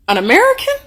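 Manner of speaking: mock-baffled, rising high; puzzled question. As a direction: lift it sharply into a baffled question. Retold clerk puzzled by her answer.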